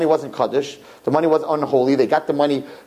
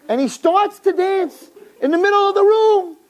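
high, loud storytelling peak; climactic telling; 4 dB louder than usual. Acted. Rabbi telling the dance, high and loud. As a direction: ring out the dance.

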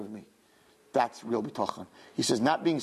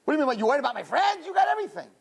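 quick, high, astonished protest; fast incredulous retort; 7 semitones higher, faster than usual. Retold or imitated. To Hashem again, fast high disbelief. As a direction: rattle off the disbelief.

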